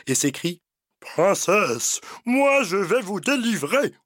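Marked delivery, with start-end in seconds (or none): 1.0-2.0 put-on heroic young prince calling out; gallant prince. 2.2-3.9 gallant prince. Acted. Prince offers rescue.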